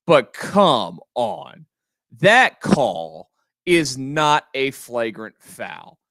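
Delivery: slow, punched words; emphatic. Real, not acted. Host insisting to listeners the call was wrong, slow and emphatic. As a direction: stress every word, slow and deliberate.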